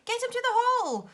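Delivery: high, loud urgent cry. A narrator's reading. Silky crying out to save Moonface, urgent. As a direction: shout for help, urgent. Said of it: "cried Silky".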